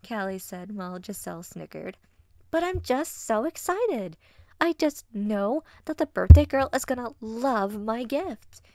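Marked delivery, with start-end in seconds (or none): 2.5-8.6 high, loud, bubbly vixen; gushing excitement. Acted. Vixen bragging about her handmade gift, excited.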